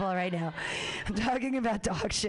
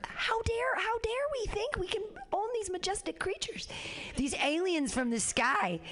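mock outraged cat owner; 11 semitones higher than usual. Mocking preachy cat owner.

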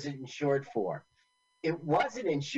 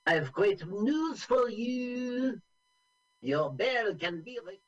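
a higher, slow, put-on young French woman; French girl voice; 7 semitones higher, slower than usual. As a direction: play the French girl breaking good news, slowly. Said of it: "the French girl knew that and said".

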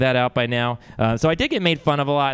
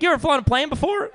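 high-pitched incredulous question; 14 semitones higher, faster than usual. Acted. Employer to a hired pilot, high incredulous question. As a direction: ask it in a high, disbelieving voice.

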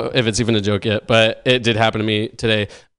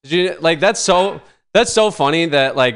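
normal talking voice

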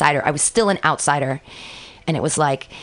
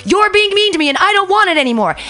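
put-on higher, louder outburst of a kid standing up for herself; child yelling back; 13 semitones higher, 6 dB louder than usual. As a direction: shout it out, fed up. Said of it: "I'd stand up and be like".

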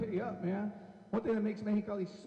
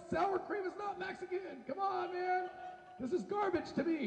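high-pitched, exasperated protest; mock indignation; 10 semitones higher, slower than usual. Mexican correcting food fans about sour cream, indignant. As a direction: object in comic disbelief, voice pitched up.

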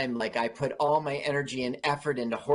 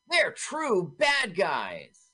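mock boss; 8 semitones higher, slower than usual. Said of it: "is gonna say to Lord Percy".

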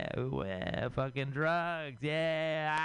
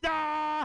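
high, loud imitated scream into the void; mock scream; 4 dB louder than usual. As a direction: let out a short hammed-up scream. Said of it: "some guy screaming into the abyss".

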